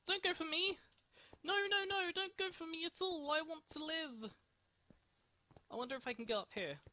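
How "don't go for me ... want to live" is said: high frantic begging; panicked pleading. Real, not acted. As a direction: plead for your life, panicking. Begging attacker to spare her.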